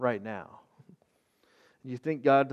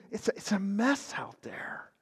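whispered aside; 4 semitones higher, 6 dB quieter than usual. Real, not acted. Pastor confiding the crisis to viewers, whispered. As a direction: drop to a whisper.

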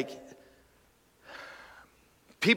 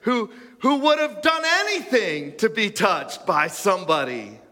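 ordinary delivery

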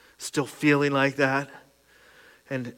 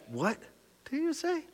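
stunned high disbelieving question; can't believe what he just heard; incredulous; 13 semitones higher, 7 dB quieter than usual. Retold or imitated. Recalling the doctor's smiling news, he asks in disbelief. Retold shock at doctor's words.

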